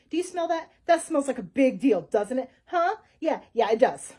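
loud, insistent shopkeeper; loud, pushy dodo who won't stop talking. A narrator's reading. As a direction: badger the listener. Dodo talks over the detective.

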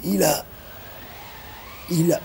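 halting, broken repetition; stammering. A narrator's reading. Grégory tries to tell what he sees, stunned stammer. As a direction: stammer with long gaps, stuck on the words. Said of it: "bégaya Grégory".

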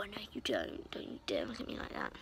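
hushed mock telling-off; whispered scolding. Girl whispers a mock scolding at a pigeon. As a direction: whisper a playful warning.

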